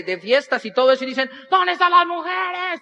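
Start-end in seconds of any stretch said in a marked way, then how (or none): 1.5-2.8 high, drawn-out crowd-rousing yell; party hype man. Retold or imitated. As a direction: holler to the crowd like a fair emcee. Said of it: "de esos que salen en las ferias". (grito de animador de feria)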